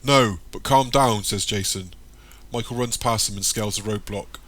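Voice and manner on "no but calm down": loud call. A narrator's reading. Guard calling to runner.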